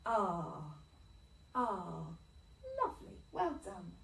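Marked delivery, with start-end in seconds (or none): 0.1-0.6 a long drawn-out rr sound for R; R sound demo. Shown as a demonstration. Teacher models the R sound for the class, drawn-out rr. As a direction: hold the R. as a long growly rr. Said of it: "Can you make that sound?".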